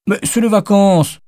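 put-on very high kid voice protesting; high-pitched childish complaint; pleading child. A narrator's reading. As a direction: plead like a child denied a treat. Child whines to father for treat.

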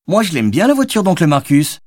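put-on high-pitched excited kid voice; enthusiastic child. A narrator's reading. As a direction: pipe up keenly, like a little child. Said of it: "s'enthousiasma Charlie".

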